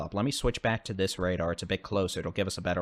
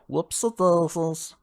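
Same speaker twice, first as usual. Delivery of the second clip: silly exclamation; 8 semitones higher, 5 dB louder, slower than usual. Real, not acted. Caught own mistake on screen.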